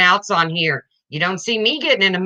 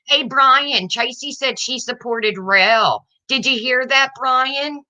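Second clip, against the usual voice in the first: high, mocking tattletale voice calling to Brian; higher whiny telling-on voice; childish tattletale; 6 semitones higher, slower than usual. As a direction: tattle like a seven-year-old, pitched up. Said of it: "You sound like you're seven".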